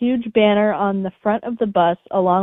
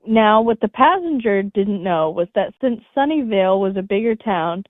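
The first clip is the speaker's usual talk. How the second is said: ordinary talk, nothing marked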